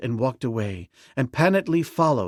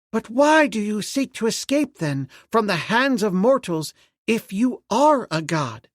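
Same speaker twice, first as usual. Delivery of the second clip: put-on high, loud girl's voice; young princess; 10 semitones higher, 4 dB louder than usual. A narrator's reading. Princess questioning the ape-man in a high voice.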